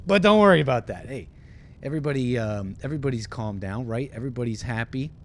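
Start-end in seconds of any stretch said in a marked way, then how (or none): 0.1-1.1 loud, high, hammed-up reassurance; mock reassurance. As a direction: burst out brightly to cover bad news. Pilot brushing off the danger.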